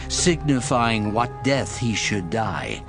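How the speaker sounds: ordinary talk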